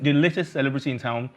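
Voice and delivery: usual speaking voice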